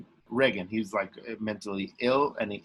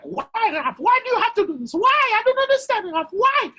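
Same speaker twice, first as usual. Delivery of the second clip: much higher, louder exasperated mother voice; impression of mom; 8 dB louder than usual. Mom reacting to son's bike plan, much higher, loud and baffled. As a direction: shout it as a baffled, alarmed mom. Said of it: "Great impression of your mom".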